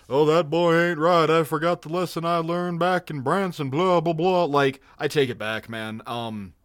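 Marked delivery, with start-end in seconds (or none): 0.1-4.4 mocking impression trailing into blah-blah; Hank. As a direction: put on a formulaic cartoon dad. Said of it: "and Hank is".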